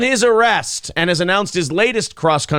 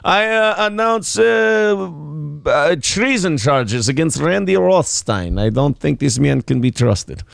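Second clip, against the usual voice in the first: halting mock Russian proclamation; Russian accent; slower than usual. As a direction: announce it haltingly in comic Russian English. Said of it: "you can do a Russian accent".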